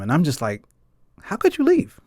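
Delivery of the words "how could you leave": high-pitched hurt cry of blame, half acted; retold angry plea. Grieving grandchild to late grandfather, high hurt reproach. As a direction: throw the question up high like a grieving kid. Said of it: "And I was just angry at him".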